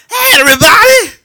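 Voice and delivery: put-on very high, loud drunken shout. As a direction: bellow it like a happy drunk. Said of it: "His drunk voice".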